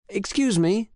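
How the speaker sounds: high, bright call across to the attendant; friendly call out. Acted. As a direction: call out politely with a smile. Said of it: "Oliver shouts with a smile".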